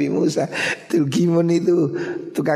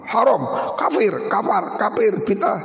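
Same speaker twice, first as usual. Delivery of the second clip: higher, ranting shout of accusations; hammed-up zealot yelling; mock angry shout; 6 semitones higher than usual. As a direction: parody a hothead yelling labels. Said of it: "galak setengah mati".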